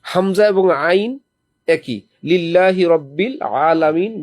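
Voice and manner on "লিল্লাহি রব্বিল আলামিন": slow chanted লিল্লাহি রব্বিল আলামিন; Quranic recitation. Shown as a demonstration. Preacher reciting the correct way before showing the Bengali one, slow chant. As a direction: recite it properly, slowly. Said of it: "Bangla কি পড়বে?".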